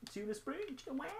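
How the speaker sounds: silly cry. Pretend.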